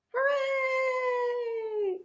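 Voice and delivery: high, soft cheer; a squeaky little hooray. Acted. Witch cheers finding root.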